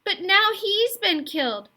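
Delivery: put-on a boy sobbing out that his father was killed. A narrator's reading. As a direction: blurt it out between sobs. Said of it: "sobbed Roderick".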